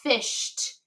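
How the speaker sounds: "Fished" whispered, the final sh and t stretched; sounding out. Shown as a demonstration. Teacher sounding out a word for spelling, whispered. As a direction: breathe "Fished" out slowly, stressing the ending t sound. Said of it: "I hear t at the end".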